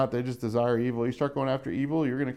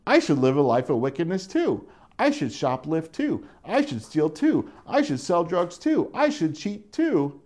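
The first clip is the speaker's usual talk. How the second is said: rising higher and slower each line; tempted fool; 5 semitones higher than usual. Retold or imitated. Simple person talking himself into sin, rising and slowing. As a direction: egg yourself on, climbing in pitch.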